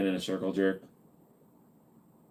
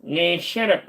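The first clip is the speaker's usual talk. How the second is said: put-on voice; 8 semitones higher, slower than usual. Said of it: "I can't do the voice".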